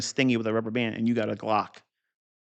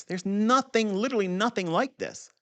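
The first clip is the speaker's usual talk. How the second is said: emphatic; 9 semitones higher than usual. Real, not acted. Boasting his method is unique.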